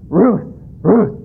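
playful mock dog bark. Talking dog joke punchline.

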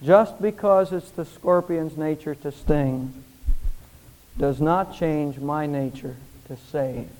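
low, slow, gentle reply; calm, measured reassurance; calm old man. Acted. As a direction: answer softly, letting each phrase settle. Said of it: "the old man said calmly".

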